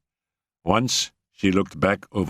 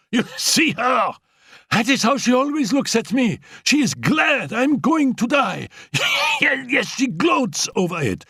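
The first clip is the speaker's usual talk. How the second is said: angry outburst. Acted. Annette shouting at Félicie, high furious jealousy. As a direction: spit the accusation, shouting. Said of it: "flung the glass down angrily".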